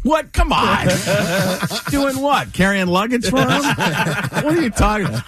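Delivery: high-pitched, incredulous protest with laughs around it; mock disbelief. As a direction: scoff at a tall tale, pitch jumping up. Acted disbelief at uncle's boasting.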